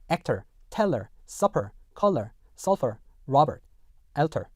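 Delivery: American accent, R-coloured endings in actor, teller, supper. Shown as a demonstration. Teacher reads example nine, American R endings. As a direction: end each of actor to alter on an American "er". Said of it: "미국 영어에서는요".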